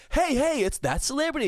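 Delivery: high, excited hammed-up greeting; mock celebrity greeting. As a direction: announce the celebrity like a cheesy host. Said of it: "We're in the era of".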